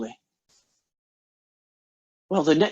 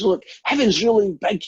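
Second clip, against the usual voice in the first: excited Scotsman, pitched up; acted excitement, higher voice; 7 semitones higher, 4 dB louder than usual. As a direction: marvel like an eager newcomer to heaven. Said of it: "Scotsman gets dead excited".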